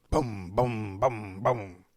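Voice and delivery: low, steady booms like a throbbing headache; imitated head pounding. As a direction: thump out each boom like a pulse.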